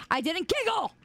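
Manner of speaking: high-pitched protest; squeaky denial. Real, not acted. Streamer caught giggling, high indignant denial. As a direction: deny it, pitch jumping up.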